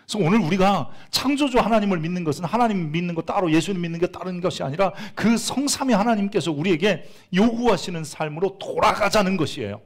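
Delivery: full-force sermon delivery; fervent preaching. Acted. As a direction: preach it out with conviction.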